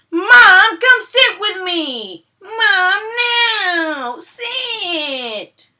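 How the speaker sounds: put-on very high, loud crying child voice; whining kid. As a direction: wail like a bratty four-year-old.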